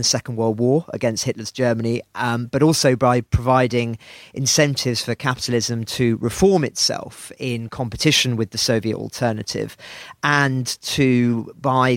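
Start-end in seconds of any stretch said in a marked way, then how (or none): none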